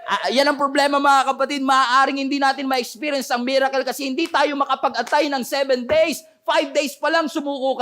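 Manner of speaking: high-pitched, impassioned exhortation; preaching. Real, not acted. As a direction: challenge the congregation for giving up. Preaching with rising force.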